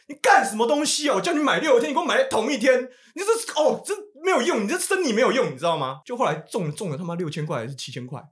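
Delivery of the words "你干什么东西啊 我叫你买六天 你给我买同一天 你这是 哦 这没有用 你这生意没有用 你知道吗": high, loud scolding yell; scolding father. Retold or imitated. Father scolding son about the lottery, loud and high. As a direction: chew him out for the wrong purchase.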